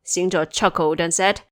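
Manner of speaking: ordinary delivery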